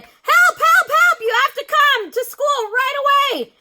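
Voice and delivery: very high, loud urgent cry; calling for help. A narrator's reading. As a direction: beg mother on the phone urgently. Ben calling mother urgently.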